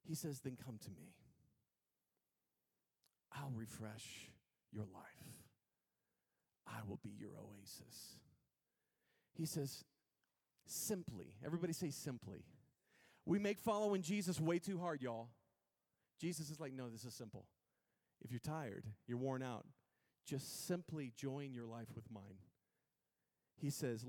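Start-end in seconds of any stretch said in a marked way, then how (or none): 0.4-8.2 low, soft and reassuring; gentle invitation. Acted. Jesus invites the weary to come to him, gently. 16.7-22.4 low, calm and easy; Jesus, reassuring. Acted. Jesus tells the worn out it's simple, calmly.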